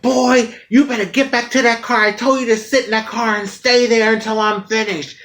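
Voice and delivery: mild mock scolding, send him back to the car; father ordering his son back to the car.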